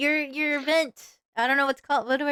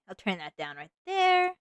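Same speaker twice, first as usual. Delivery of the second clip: ordinary talk, nothing marked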